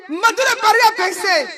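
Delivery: high-pitched proclamation; mock outcry. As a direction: announce it high and playfully indignant. Mock outcry about Mado. (proclamer d'une voix aiguë)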